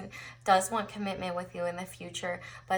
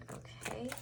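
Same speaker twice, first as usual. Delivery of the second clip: whispered; 3 semitones higher, 12 dB quieter than usual. Real, not acted. Whispered okay.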